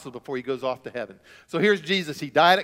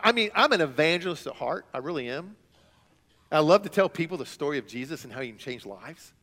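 ordinary talk, nothing marked

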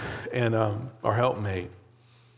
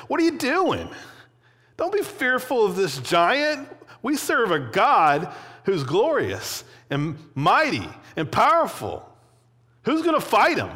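high, indignant rallying cry; angry; 8 semitones higher than usual. Acted. Young David rousing cowering Israelites against the giant, angry. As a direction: challenge them in outrage, pitch leaping up. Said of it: "He's, like, angry about it".

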